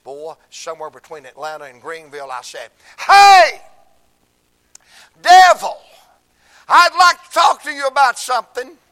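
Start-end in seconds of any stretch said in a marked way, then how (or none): 3.0-8.8 loud, high shouting dare to the devil; challenging shout. Retold or imitated. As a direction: call the devil out, bellowing. Calling out the devil.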